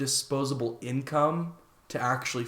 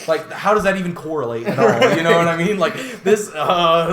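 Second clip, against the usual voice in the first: laughing; 5 semitones higher, 9 dB louder than usual. Real, not acted. Laughing at absurd lyric.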